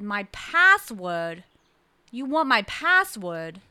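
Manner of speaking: loud, drawn-out incredulous question; indignant New England caller; talking loud. Retold or imitated. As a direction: punch out password in disbelief. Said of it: "And she's talking loud".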